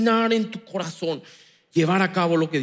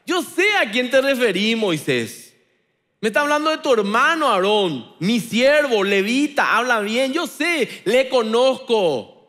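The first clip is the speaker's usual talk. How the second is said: raised, slowed sermon with punched words; full-force preaching; 6 semitones higher, 4 dB louder than usual. Acted. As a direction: preach it with fire to the congregation.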